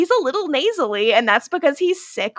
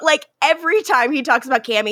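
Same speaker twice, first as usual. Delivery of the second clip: usual speaking voice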